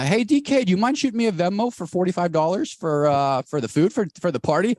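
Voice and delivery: a lightly voiced party host, pitched high and casual; high, breezy mock host asking for cash; imitating party host. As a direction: toss off the request like a cheeky host. Host demands Venmo for party food.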